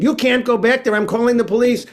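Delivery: high, loud outraged yell; screaming. Retold or imitated. Receptionist yelling at La Diabla in the back, high and loud. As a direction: shout after them, threatening. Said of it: "the woman is screaming".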